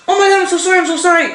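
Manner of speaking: high, rushed oh-my-God sorry; flustered apology. Retold or imitated. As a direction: gasp it out, mortified. Bumped into a child, apologizing.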